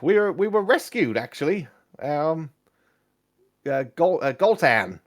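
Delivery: animated companion with news; excitable adventurer Kezik. Acted. Game master plays Kezik.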